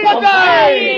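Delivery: high shouted cheer. Acted. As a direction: shout it back with joy. Echoing the cheer.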